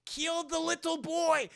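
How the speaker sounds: high, slow, hammed-up German villain; Nazi scientist. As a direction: shriek orders like a cartoon mad scientist. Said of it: "the Nazi scientist is like".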